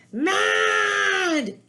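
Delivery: bursts out high and loud, furious; a kid's furious outburst; angry shout. Acted. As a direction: yell it like a toddler losing it. Bear's meltdown acted out.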